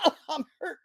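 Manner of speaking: high whiny mock "I'm hurt"; mimicking a faker who pretends to be hurt, in a high whine; mock fake injury. Host mocks fighter faking an injury, high mock whine. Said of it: "then decides".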